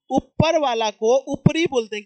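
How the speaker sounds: slow and loud, leaning on ऊपर; teacher emphasis. Shown as a demonstration. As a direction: spell out ऊपर वाला को ऊपरी for the class, slow and loud. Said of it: "क्या बोलते हैं?".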